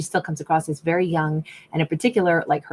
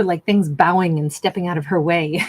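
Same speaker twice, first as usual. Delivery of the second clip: ordinary talk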